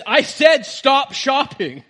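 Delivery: high, loud, slow acted command; imitated exasperated yell, word by word; insistent shout. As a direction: repeat the order forcefully to people ignoring it. Acts father repeating his ignored order.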